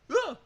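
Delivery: short startled yelp; startled scream. Acted. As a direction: jump with fright. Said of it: "that didn't work".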